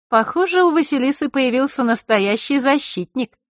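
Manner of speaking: put-on high, light girlish voice; high-pitched, guileless-sounding tease; mock innocent. A narrator's reading. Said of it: "самым невинным видом произнесла Диана".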